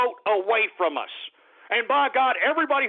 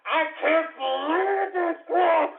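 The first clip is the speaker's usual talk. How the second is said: high, slow, drawn-out outcry; exasperated disbelief; 5 semitones higher, slower than usual. Real, not acted. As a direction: stretch it out in exasperation. Dismay escalates into outburst.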